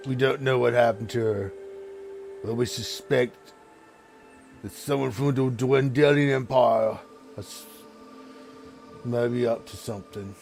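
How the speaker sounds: a hint of a troubled ogre chieftain asking for help; ogre lord. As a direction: ogre ruler, worried, asking the party for help.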